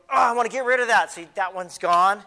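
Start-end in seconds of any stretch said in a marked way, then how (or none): 0.1-1.1 higher, louder and fast, eager to be rid of it; a hammed-up cry to shove the anger away; playful kid-sermon exclamation; mock eager outburst. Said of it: "And then you go".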